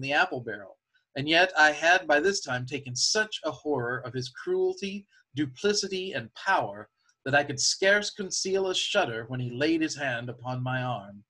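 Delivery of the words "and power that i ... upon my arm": ordinary delivery, nothing marked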